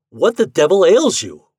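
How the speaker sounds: loud, high indignant roar. A narrator's reading. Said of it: "roared Ford".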